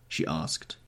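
plain reading voice

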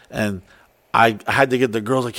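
ordinary talk, nothing marked

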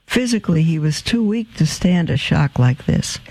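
plain reading voice

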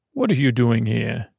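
ordinary talk, nothing marked

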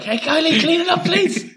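calling out. Acted. Acting out group calling to Kylie.

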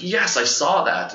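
high, drawn-out excited yes; excited confirmation. Retold or imitated. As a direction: imitate her thrilled, slow recognition. Retold amazed confirmation of vision.

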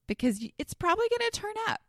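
high, fast, airy reassurance; breezy reassurance. Retold or imitated. Telling her mother-in-law not to worry, a high quick breezy reassurance. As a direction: toss it off lightly, high and quick.

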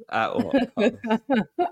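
high, drawn-out laughing question; laughing lament. Real, not acted. Joking with co-host about embarrassment as content, laughing. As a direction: stretch it out through laughter.

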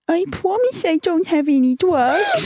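put-on high, slow baby voice. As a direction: plead sweetly like a small child. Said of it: "put on a baby voice".

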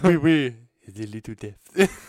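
hammed-up bad French accent, laughing, loud start; terrible French accent. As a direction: do a silly, badly faked French accent. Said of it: "[name]'s terrible accent".

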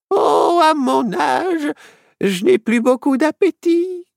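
put-on high, drawn-out old woman's voice; high-pitched elderly lady, lingering on words. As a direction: play a frail old dame, pitched high. Old witch playing harmless old lady.

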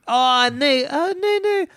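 very high-pitched mock voice; imitating the student's voice. Showing co-host how the student talked, high-pitched imitation. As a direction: squeak it far above your own voice. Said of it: "ungefär så här när han pratade".

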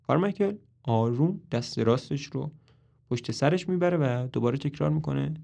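ordinary delivery, nothing marked

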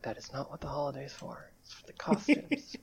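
hushed correction under the breath; whispered aside, correcting. Acted. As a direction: mutter it with no voice. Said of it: "Olivia's just like".

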